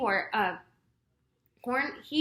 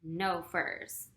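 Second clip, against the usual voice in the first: straight face; 5 semitones lower, 6 dB quieter than usual. A narrator's reading. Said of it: "taking care to keep a straight face".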